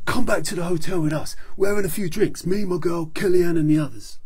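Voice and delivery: loud, high, beefy hero voice; big friendly praise from a tough guy; hearty action hero. Acted. Action Man cheering up Doctor Z, hearty and loud.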